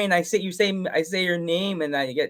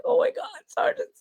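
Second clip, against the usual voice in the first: starstruck; 3 dB quieter than usual. Retold or imitated. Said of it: "I was like".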